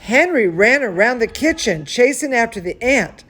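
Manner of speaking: plain reading voice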